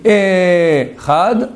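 Ehad with a drawn-out first E; wrong long E. Shown as a demonstration. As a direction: stretch the E of Ehad slowly. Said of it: "je veux dire Dieu n'est pas un". (Ehad prononcé avec le E traîné)

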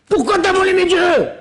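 high, indignant accusation; high outraged father-in-law; angry Laban. Acted. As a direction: accuse him of theft.

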